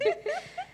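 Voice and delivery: short, very high laugh. Real, not acted. Amused at the memory of driving on, a high laugh. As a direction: let a high giggle escape.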